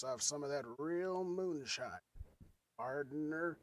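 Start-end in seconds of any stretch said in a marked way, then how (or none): 2.8-3.6 slurred cowboy saying partner; a cowboy falling off his horse; drunk cowboy drawl. Pretend. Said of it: "like a cowboy about to fall off his horse".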